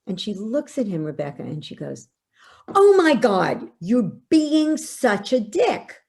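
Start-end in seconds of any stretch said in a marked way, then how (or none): 2.7-5.9 loud, high insult at her father; teen daughter snapping. Retold or imitated. Teen daughter lashing out at her father, loud and high. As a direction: snap it out like an angry fourteen-year-old.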